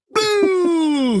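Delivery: high, drawn-out "boo" launch noise; playful sound effect of a ball flying; imitated dodgeball throw. Dad pretending to dodgeball his son, playful high whoosh.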